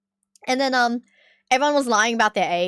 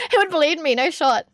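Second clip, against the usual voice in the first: ordinary talk, nothing marked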